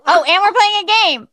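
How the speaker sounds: bright, loud, high announcement; excited. Real, not acted. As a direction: announce it with glee.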